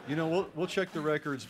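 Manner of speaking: chuckling through the words; amused, half laughing. Real, not acted. Joking about checking admission records.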